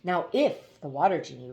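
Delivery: usual speaking voice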